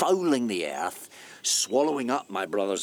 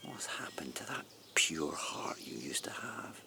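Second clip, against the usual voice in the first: whispered, much softer, grieving; sorrowful whisper; 5 dB quieter than usual. Acted. Man whispering his reproach to the woman he once loved. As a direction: hush it like a secret regret. Intimate reproach to former love.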